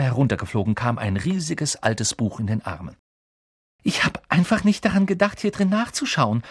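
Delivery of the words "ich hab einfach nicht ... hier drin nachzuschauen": put-on high, excited girl's voice; excited rush of realisation, pitched up; agitated. A narrator's reading. Girl returning with the book she forgot, agitated. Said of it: "flüsterte sie erregt".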